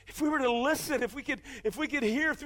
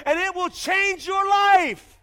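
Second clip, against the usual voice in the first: slow, higher, loud proclamation; preaching shout; 5 semitones higher, 5 dB louder, slower than usual. Acted. Preacher proclaims to congregation, slow loud shout. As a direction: declare it over the whole room.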